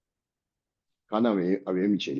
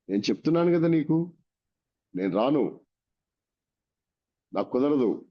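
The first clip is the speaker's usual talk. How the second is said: a firm mock refusal, higher at the start; 6 semitones higher than usual. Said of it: "అని గట్టిగా చెప్పాడు". (గట్టిగా నిరాకరిస్తూ)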